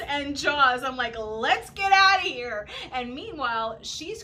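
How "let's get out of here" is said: urgent call. Retold or imitated. Fear of sharks, bleeding friend.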